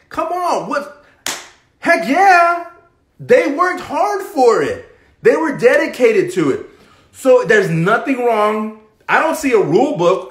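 high, loud, worked-up outburst; little tantrum. Real, not acted. Coach ranting to viewers in a high, loud outburst. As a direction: let it burst out in a fired-up little rant. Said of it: "little tantrum there".

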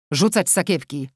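lightly voiced gruff command; a hint of a bandit's bark; robber's order. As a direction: order them to throw purses. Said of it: "rozkazał drugi z napastników".

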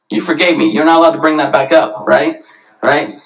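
ordinary talk, nothing marked

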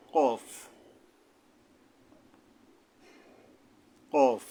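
কফ loud and heavy from the tongue root; thick, heavy articulation. Shown as a demonstration. Teacher models the two-dot letter, loud thick কফ. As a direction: hit কফ hard and thick, far back at the tongue root. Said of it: "আকার উচ্চারণ করতে হয় না".

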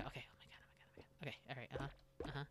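hushed, breathy whisper; tense whisper. Real, not acted. Player hiding from the cat, whispering tensely. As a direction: whisper so the cat won't hear.

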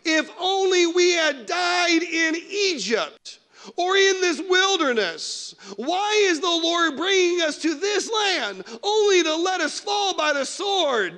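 high, slow, wailing complaint, half-mocking; grumbling Israelites. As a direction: whine at Moses like a frightened crowd. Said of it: "the whole assembly said to them".

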